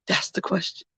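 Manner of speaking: hushed whisper on the question. Real, not acted. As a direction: drop to a breathy whisper. Quiet rhetorical point after pause.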